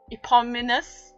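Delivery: ordinary delivery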